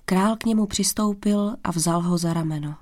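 plain reading voice

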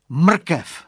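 high, loud eureka; exclaimed. A narrator's reading. Boy has idea for friend, loud exclamation. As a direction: burst out with the idea.

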